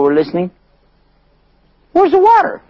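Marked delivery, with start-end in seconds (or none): 1.9-2.6 very high, loud call; high yell into the well. Acted. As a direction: shout down the well. Caravan man calls down well.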